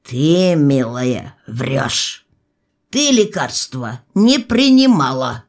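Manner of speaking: stern feldsher's accusation; measured, no-nonsense reproof. A narrator's reading. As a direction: accuse the patient severely. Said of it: "и строго молвил".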